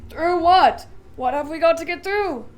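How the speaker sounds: alarmed question. A narrator's reading.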